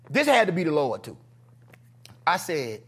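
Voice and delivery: ordinary delivery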